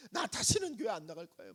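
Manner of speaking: high, loud wailing complaint; tearful protest. Retold or imitated. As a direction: cry out your hurt to the pastor. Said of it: "막 울면서".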